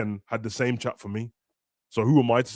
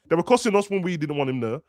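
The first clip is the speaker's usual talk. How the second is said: ordinary delivery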